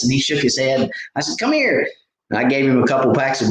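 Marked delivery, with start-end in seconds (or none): 1.4-1.9 much higher, bright come-here; eager call. Retold or imitated. Man calling a shy boy over for free baits, high eager call. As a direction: wave the shy kid over.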